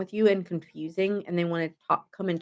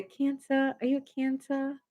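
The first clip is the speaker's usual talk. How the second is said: silly sing-song voice; 5 semitones higher, 6 dB quieter than usual. Pretend. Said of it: "I wanna say".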